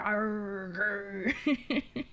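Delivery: growled pirate arr; playful pirate growl. Pretend. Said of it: "I sound like a pirate".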